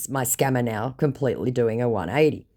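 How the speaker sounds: ordinary delivery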